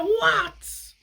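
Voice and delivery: high, loud snappy "What?"; irritated snap. Retold or imitated. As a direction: bark it like an annoyed mum. Snapping at kids mid-period.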